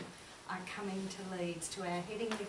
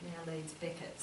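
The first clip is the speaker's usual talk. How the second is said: ordinary talk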